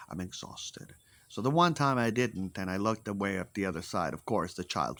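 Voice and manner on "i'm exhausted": breathy exhausted whisper, barely voiced; weary whisper. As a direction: let it out like you have nothing left. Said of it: "being like".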